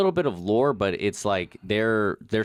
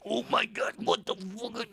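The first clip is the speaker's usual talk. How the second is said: panicked disbelief; 13 semitones higher, 5 dB quieter than usual. Retold or imitated. Terrified reaction while chased.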